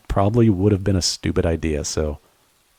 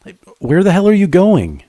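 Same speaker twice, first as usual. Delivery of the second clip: much higher, loud alarmed call; shouting after her; 11 semitones higher, 5 dB louder than usual. Acted. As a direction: yell after someone running away into the rain. Partner runs off, calling after.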